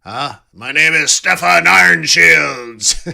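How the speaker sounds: slow, hammed-up Viking accent with a laugh; mock Viking accent. Streamer jokes how chatter Stefan introduces himself, mock Viking accent. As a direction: boom it out like a Viking chieftain, laughing. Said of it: "Like a Viking?".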